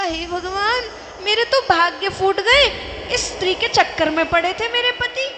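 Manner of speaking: a wife finding another woman, wailing loudly. Retold or imitated. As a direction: cry out as if your luck is ruined. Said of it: "जोर जोर से चिल्ला रही थी".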